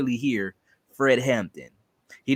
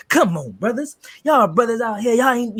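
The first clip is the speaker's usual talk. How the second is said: higher, louder rally call to the brothers; seventies revolutionary swag; 9 semitones higher, 5 dB louder than usual. Retold or imitated. Imitating Fred Hampton's swagger for his co-host, a higher, louder rally call. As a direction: hype up the crowd like a seventies Panther. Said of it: "seventies sort of swag".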